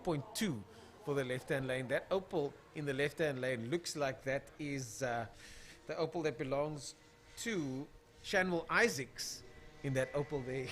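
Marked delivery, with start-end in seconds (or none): 1.9-10.7 stalling with fillers. Real, not acted. Commentator looking for a driver's name on paper, stalling. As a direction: stretch it out while hunting for the name. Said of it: "while I'm talking, I'm just doing, uh, fillers".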